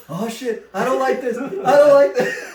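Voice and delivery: high, loud mock dread building to a yell; mounting panic. Brother as the coaster is going up, mounting panic. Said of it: "he starts screaming".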